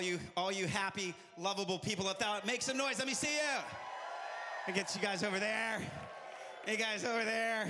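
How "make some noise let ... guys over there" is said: hyping the crowd. Real, not acted. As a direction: call out to pump up the room. Rallying the crowd.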